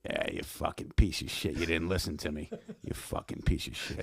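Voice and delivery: hushed, raspy near-whisper of a furious dad; gravel-voiced father scolding his fighter in a low hiss; Marty voice. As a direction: rasp out the insult under your breath like an old fight trainer. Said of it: "give me what he would say in a Marty voice".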